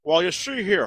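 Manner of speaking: lightly voiced cartoon rooster; Foghorn Leghorn. Shown as a demonstration. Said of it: "thought that was your snobbish voice".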